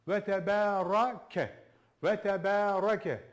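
Ve tebarake with a heavy ra, slow and loud; thick ra. Shown as a demonstration. Teacher showing the thick ra in tebarake. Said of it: "Sadece Ra'yı biraz aradan sıyır".

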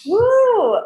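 high, bright whoop of delight; excited whoop. Real, not acted. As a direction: let out a thrilled woo. Excited reaction to the question.

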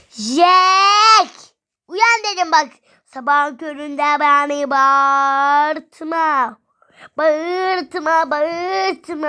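loud, high, drawn-out wake-up shout; exasperated pretend yell; play-acted yelling. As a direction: shout to rouse a sleeper, stretching the words. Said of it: "Jack diye bağırıyor".

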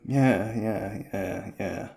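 ordinary delivery

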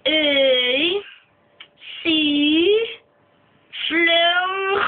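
A, C drawn out, then Fleur ending in a throat rasp; comic spelling out. Acted. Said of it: "what is spell your name".

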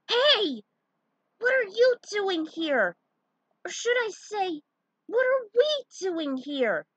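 put-on high, loud boyish shout; a startled kid blurting out his surprise; boy hero amazed to meet his grown-up self; excited kid voice. Young Link meets adult self.

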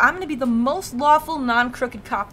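real loud exasperation; fed-up rant. Real, not acted. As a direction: snap at the game and storm off.

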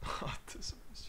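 slow breathy whisper. Real, not acted. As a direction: whisper it under your breath. Whispered aside while playing.

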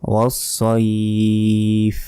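ওয়াস-সাইফ as "was-soiiif", thick s; slow recitation holding the end of ওয়াস-সাইফ; rounded "soi", long-held; heavy sad. Shown as a demonstration. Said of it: "সইফ বলবেন".

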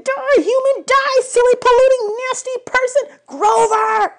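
very high, shrill battle cry; frantic satyr voice. A narrator's reading. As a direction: satyr in gaming frenzy. Grover playing the hunt game.

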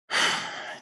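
long, soft, voiceless exhale; sigh of relief. Retold or imitated. Client after finally saying it, relieved sigh. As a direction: imitate letting the pressure out in one breath. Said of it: "Takes a sigh of relief".

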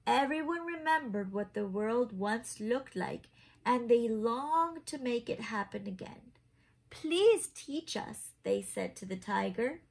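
lively picture-book narration; reading to children. A narrator's reading. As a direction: read it like a bedtime story for little ones. Reads picture book aloud.

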